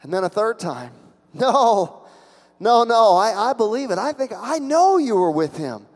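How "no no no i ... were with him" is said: high, insistent accusation; acted insistence, voice lifted; certain accuser. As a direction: press the charge with raised pitch. Acting third accuser.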